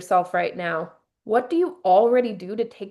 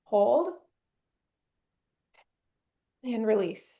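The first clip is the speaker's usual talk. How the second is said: guided breathing. Shown as a demonstration. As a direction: talk the room through each breath, gently. Leads guided breathing exercise.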